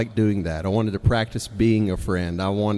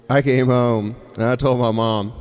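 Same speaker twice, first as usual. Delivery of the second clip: ordinary delivery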